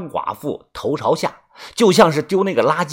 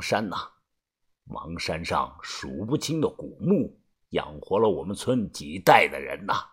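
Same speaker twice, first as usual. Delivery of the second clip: much lower, soft reminiscing elder; wistful old man; 9 semitones lower, 4 dB quieter than usual. A narrator's reading. Old man gazing at Mang Mountain, low wistful voice. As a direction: sigh over the mountain you still love.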